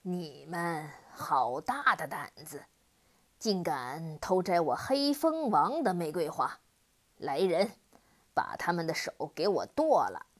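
low roaring demon voice; demon's roar. Acted. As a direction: thunder at the trespassers like a monster. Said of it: "恶魔大喝一声".

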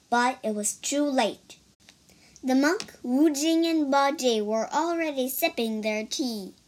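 ordinary delivery

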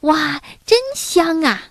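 high-pitched admiring exclamation; excited cry. A narrator's reading. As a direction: cry out together at a delicious smell. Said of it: "他们叫起来". (高声兴奋地惊叹)

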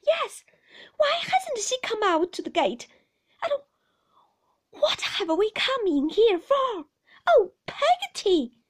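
high, loud, tearful protest; child's alarm building to a wail; distressed child. A narrator's reading. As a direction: plead with mounting panic, near tears. Distressed child near tears questions nurse.

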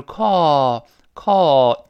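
"call" stretched slow with a lazy open aw; slow American "call", lazy lips. Shown as a demonstration. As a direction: drawl "call" with a slack mouth. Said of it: "怎么说成啊".

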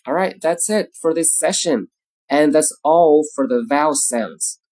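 usual speaking voice

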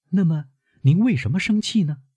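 put-on high-pitched young woman questioning her grandfather; concerned girl, pitched well up; worried granddaughter. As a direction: lift the voice high, anxious questions.

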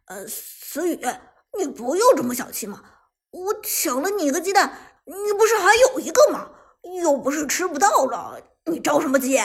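loud, defensive wheedling; frowning protest. Acted. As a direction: brazen self-excuse with a rising voice. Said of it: "皱眉说道".

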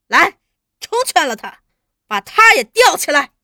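shouted command. A narrator's reading. Interrogator ordering sister hung up, shouted. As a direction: call your men to it.

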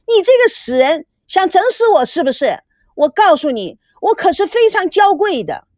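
high, affected tantrum voice; pampered scolding. Acted. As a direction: scold shrilly like an offended diva. Pampered woman scolds the porter. (娇气地尖声骂人)

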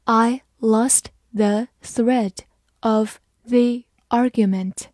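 slow, in two chunks; slow reading. Shown as a demonstration. Teacher reading slowly in two parts for the class. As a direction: read it slowly, pausing after thread. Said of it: "好，慢慢来读。".

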